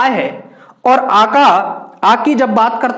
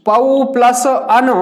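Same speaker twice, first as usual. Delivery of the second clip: slow dictation; slower than usual. Shown as a demonstration. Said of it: "plus के बाद लिखते हैं".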